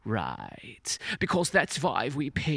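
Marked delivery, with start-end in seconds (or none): 0.0-1.0 whispered aside. Real, not acted. Aside under his breath.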